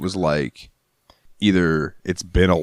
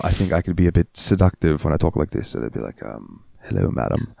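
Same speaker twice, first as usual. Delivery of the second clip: amateur British gentleman accent, softly trailing; seductive English accent; 2 semitones lower, 3 dB quieter than usual. Shown as a demonstration. Suitor inviting a madam out for tea, English accent. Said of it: "my English accent, even though I'm not very good at it".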